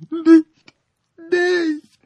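very high thin squeak; piping it out weakly; squeaky piping. A narrator's reading. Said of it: "piepste die Ritterin schwach".

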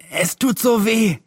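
high, slow, pained wail; acted whimpering complaint of a hurt boy; whining in pain.

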